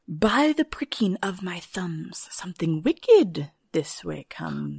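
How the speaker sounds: slow, whispered spooky chant; witches' incantation. Retold or imitated. The witches foretelling Macbeth's arrival, slow spooky whisper. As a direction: hiss it like a witch. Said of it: "And then they say".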